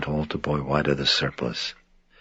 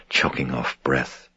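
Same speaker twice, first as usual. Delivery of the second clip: ordinary delivery, nothing marked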